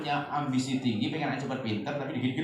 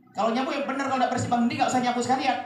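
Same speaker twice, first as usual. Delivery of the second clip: put-on higher and louder, scolding a child over sweeping; scolding mother; 9 semitones higher, 5 dB louder than usual. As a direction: snap at the child like an angry mom. Ibu galak memarahi anak. (ibu memarahi anak, suara tinggi dan keras)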